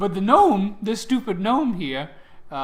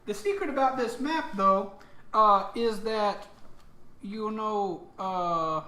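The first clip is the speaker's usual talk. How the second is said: higher, showy god voice; lightly put-on, pitched-up god; lightly voiced playful god; 5 semitones higher, slower than usual. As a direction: present it like a playful deity. Plays the god explaining the map.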